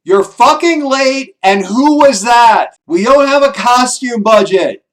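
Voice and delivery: loud, high, slow furious yelling; angry shout. Pretend. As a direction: scream at the late sibling. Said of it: "what your sister shouts is".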